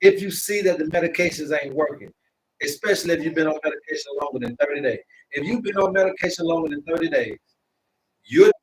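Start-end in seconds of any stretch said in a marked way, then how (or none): 2.6-5.0 fast, run-together first pass; rushed. Real, not acted. Host warning viewers about medication, rushing it the first time. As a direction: hurry through it. Repeats the medication line twice.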